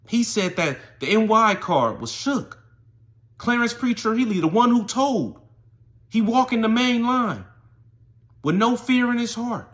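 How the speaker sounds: high, loud, emphatic storytelling; dramatic proclamation. Real, not acted.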